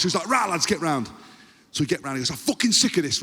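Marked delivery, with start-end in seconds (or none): 0.3-1.1 harsh northern coach's call, higher pitched. Retold or imitated. Coach gathering his squad, harsh command. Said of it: "it's always just so harsh". 2.3-3.2 high, mock-angry coach; harsh. Coach fed up with his squad, harsh. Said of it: "it's always just so harsh".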